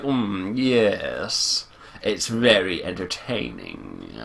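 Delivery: low, soft villain savoring every word; Marcus. Acted. Villain watching someone get killed, savoring pleasure. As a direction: relish it slowly, deep and hushed. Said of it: "taking pleasure in everything".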